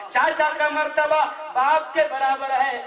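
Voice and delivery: ordinary delivery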